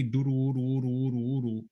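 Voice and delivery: "duru, uru" sung out in tight throaty syllables; closed duru syllable. Shown as a demonstration. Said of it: "just that itself kinda closes off your, your throat".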